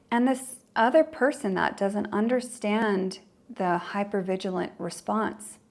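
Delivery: normal talking voice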